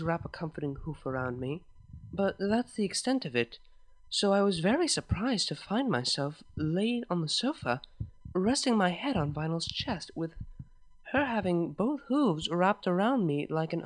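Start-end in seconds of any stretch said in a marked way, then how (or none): none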